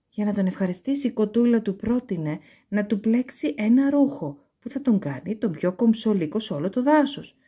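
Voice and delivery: usual speaking voice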